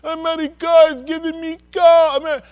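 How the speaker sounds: high, slow, loud mock voice of a grateful immigrant. Mocking a newcomer who calls a friend back home to praise America. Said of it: "so then you call back your friend".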